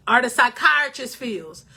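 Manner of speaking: normal talking voice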